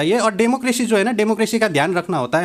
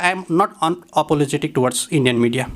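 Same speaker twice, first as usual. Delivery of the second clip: deliberate statement; 3 semitones lower, much slower than usual. Real, not acted. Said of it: "slow deliberate statement".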